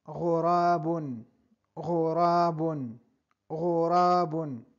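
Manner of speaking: ghurabun slow, gh rasped; vocabulary drill. Shown as a demonstration. Teacher repeats ghurabun for learners, slow and clear. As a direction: say ghurabun carefully. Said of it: "El cuervo".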